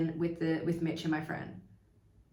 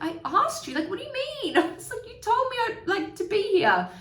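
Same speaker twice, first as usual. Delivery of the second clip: much higher, laughing through confused words. Retold or imitated. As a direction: act baffled and giggle it out. Confused reply, mimicked with laughter.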